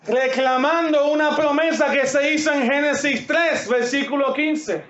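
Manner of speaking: preaching. Real, not acted. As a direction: preach it out with fervor to the church.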